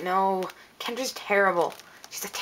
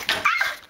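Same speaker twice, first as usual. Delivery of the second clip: very high short shriek; frustrated scream. Acted. Girl at the end of her patience, high scream.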